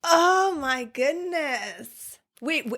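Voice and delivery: drawn-out amazed gasp of awe. Real, not acted. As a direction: stretch it out in awe. Reaction to ghost story.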